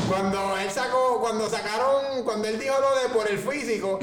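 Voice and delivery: jokingly calling out the producer; loud teasing. Real, not acted. Teasing the producer who laughed.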